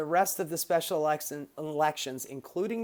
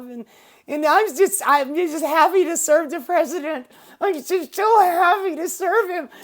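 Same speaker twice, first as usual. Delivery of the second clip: high, loud, gushing fake-cheerful voice; mock her forced-smile happiness, way up high; fake happy Stefanik; 9 semitones higher, 10 dB louder than usual. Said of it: "And she goes".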